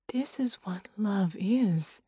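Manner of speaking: slow, whispered sincerity; tender whisper. A narrator's reading. Red telling the woman what love is, slow and whispered.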